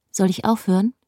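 usual speaking voice

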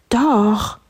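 high insistent contradiction from above; calling princess. A narrator's reading. Princess from the tower top, high insistent call. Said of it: "rief die Prinzessin von oben".

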